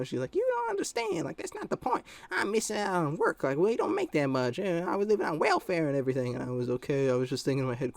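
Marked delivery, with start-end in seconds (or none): 0.3-6.3 very high mock-exasperated mother; imitated mad mom. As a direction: plead and complain in a mom's high voice. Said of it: "she was all just mad".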